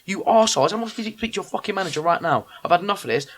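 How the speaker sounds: high-pitched indignant rant, retold; aggrieved tirade, acted out; losing his rag. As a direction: replay an outraged telling-off, piling up grievances. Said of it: "I just lost my rag".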